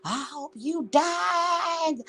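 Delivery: slow, high, whimpering mimic of his threat; mock crying threat. Mocking Mike's threat on the phone, slow whining cry. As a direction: mimic his death wish like a wailing child. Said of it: "You was just".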